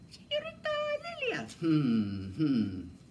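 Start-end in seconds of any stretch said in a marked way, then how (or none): 0.1-1.5 put-on high, softer girl puppet voice; a lightly voiced little puppet girl. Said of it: "Y con". (voz aguda de títere)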